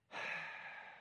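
heavy exasperated sigh; breathy huffing sigh through the nose. Shown as a demonstration. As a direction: huff out a long annoyed sigh. Huffer sighing in bed.

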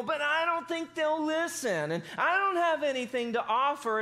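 high-pitched mock whining, stretched out; Moses. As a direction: whine it like a hammed-up complainer. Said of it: "And he's just whining".

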